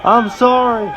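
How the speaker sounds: high, loud, slow begging cries; terrified pleading scream. Acted. Bleeding cheater begging for mercy and help, panicked high scream. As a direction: scream for your life under the knife. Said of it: "lays on the table and screams".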